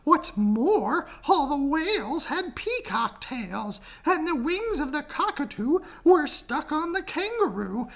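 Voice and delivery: high, fretful bird voice; pleading birds. Acted. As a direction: complain in a high chirpy voice.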